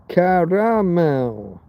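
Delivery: slow Caramel, three syllables; spelled-out caramel. Shown as a demonstration. Showing viewers how to say Caramel, slow and deliberate. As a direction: sound out every syllable of Caramel, slowly. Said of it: "How do you say Carmel".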